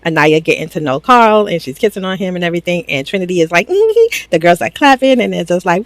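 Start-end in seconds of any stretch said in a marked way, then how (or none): 3.7-4.3 high sing-song mm-hmm; a pointed, way-up hum; knowing mm-hmm. Retold or imitated. Trinity watching the clips, smug and knowing.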